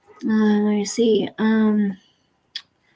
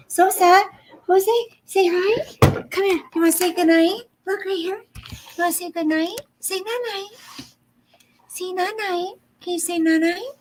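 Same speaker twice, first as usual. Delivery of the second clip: cooing, much higher pitch; pet-coaxing voice; 8 semitones higher than usual. Real, not acted. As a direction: coax a pet in a high little voice.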